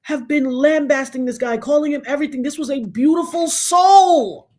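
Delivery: imitated anguished wail, pitched high; retold crying. Father lamenting how [name] was treated, imitated crying. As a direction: cry out in grief as the overcome father, acted. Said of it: "he was crying".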